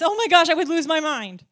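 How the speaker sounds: retelling her own careless phrase, loud and high; mock exclamation. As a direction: throw it out like a dramatic joke. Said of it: "notice myself saying this".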